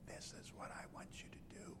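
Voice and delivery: voiceless whisper; showing how no one could hear him; laryngitis whisper. Shown as a demonstration. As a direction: barely breathe the words out. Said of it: "Now, you can't hear me".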